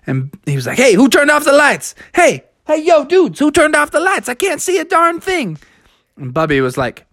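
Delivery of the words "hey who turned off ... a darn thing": high-pitched indignant hollering; blinded, flustered bull. Acted. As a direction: demand the lights back on. Blinded bull complains loudly.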